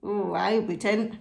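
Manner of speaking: ordinary delivery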